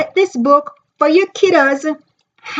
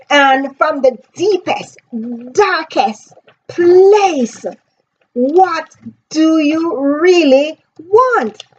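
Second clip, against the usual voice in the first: slow, higher, drawn out with weight; 4 semitones higher, much slower than usual. Retold or imitated. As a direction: pull it from the darkest place. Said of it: "He's like".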